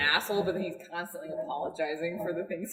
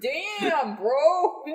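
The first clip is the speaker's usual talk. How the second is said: high, loud Canadian bro "Damn, bro."; hammed-up Canadian bro exclamation; bro accent; 8 semitones higher, 5 dB louder than usual. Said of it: "It's my bro accent".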